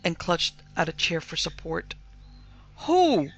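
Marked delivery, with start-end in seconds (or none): 2.9-3.3 high, loud one-word demand; indignant cry. A narrator's reading. Mistress on hearing of intruders, indignant cry. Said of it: "cried Missus Townsend".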